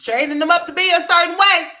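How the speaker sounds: the same words shouted high and loud; loud outraged retake; outraged yell. Real, not acted. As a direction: blow up on the repeat. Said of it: "repeat said louder".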